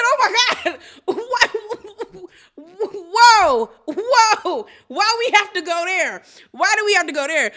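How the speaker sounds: high, loud, laughing disbelief; whoa, why we have to go there, high and laughing; shocked and laughing. Real, not acted. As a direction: gasp at the drama, cackling. Host reacting in shock, laughing.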